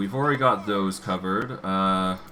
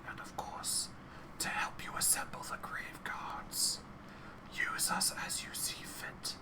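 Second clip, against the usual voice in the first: soft whispering chorus of skulls; 15 dB quieter than usual. Acted. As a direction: breathe every word as a whisper. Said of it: "their whispers blending together in a chorus".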